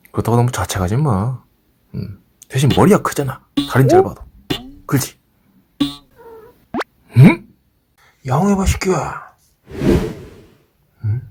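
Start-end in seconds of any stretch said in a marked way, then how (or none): 3.7-5.2 squeaky teasing. Real, not acted. 7.2-11.2 coaxing pet talk. Real, not acted.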